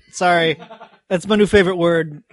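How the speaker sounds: normal talking voice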